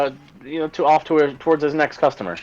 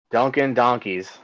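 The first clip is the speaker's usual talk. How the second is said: ordinary talk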